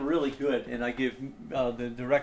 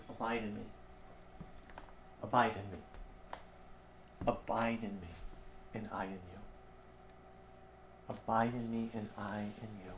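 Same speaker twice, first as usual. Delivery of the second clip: tender quiet plea; 5 semitones lower, 10 dB quieter than usual. Acted. Jesus speaking gently to disciples.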